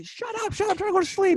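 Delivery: high-pitched imitation of an irritated yell; mock a girlfriend yelling from bed. Girlfriend yelling at him over the game, high mock yell. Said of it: "My girlfriend was yelling at me".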